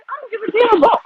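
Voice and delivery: high, loud fed-up outburst; exasperated outburst. Retold or imitated. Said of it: "I'm like".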